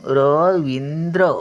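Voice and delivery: रवींद्र said slowly, each syllable clear, the final द्र crisp; teacher stretching रवींद्र so pupils hear the र; slow careful naming. Shown as a demonstration. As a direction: sound out रवींद्र slowly for the class. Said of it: "आता हा र बघा कुठे येणार तो".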